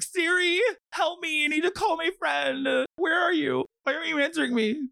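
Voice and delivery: high, whiny helpless millennial pleading with Siri; panicked millennial. Retold or imitated. Mocking clueless millennials stuck with a rotary phone. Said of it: "millennials looking at one and being like".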